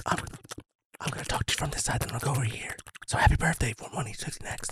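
whispered, side to side; hushed ASMR whisper in the ear. Pretend. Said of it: "the A M S R M R thing".

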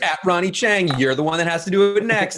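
high-pitched imitation of a social media challenger; peppy mock tagging announcement; mock challenge callout. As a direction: call someone out for a challenge, hammed up. Imitates push-up challenge tag.

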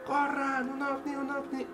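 high-pitched mock yell calling everyone out; retold shout. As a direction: imitate shouting for people to come see. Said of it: "Y empecé a gritar así".

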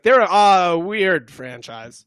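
loud, drawn-out mimicry; imitated quote. Host imitating the kid in the clip, slow and loud. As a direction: stretch it out slowly, imitating the kid. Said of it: "but he was like".

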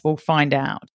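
normal talking voice

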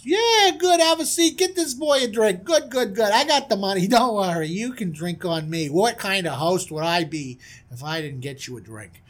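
hammed-up hearty hospitality; expansive princely host. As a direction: play the big spender buying a round. Prince plays generous host.

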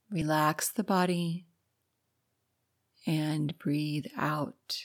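hushed meditation guide leading breathing; guided meditation. Acted. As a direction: ease the listener into calm, gently.

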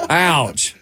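ordinary delivery

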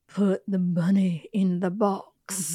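slow, drawn-out bad Alabama accent; mock Alabama movie-hero drawl. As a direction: imitate an overdone antebellum Southern accent, slowly. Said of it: "he has this Alabama accent that is so bad".

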